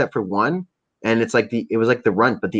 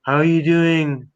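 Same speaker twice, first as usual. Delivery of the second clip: higher, fussing voice of the friend; lightly voiced concerned friend filming; imitating concerned friend; 6 semitones higher, slower than usual. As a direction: play the worried buddy checking in. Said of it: "he's like".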